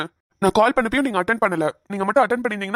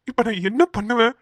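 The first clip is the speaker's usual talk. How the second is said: ordinary talk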